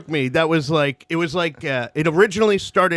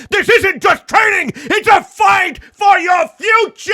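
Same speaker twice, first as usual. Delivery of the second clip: louder, higher, rallying battle cry; epic shouting trailer; 6 semitones higher, 5 dB louder than usual. Acted. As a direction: shout it like a gladiator speech. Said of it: "more like Russell Crowe Gladiator".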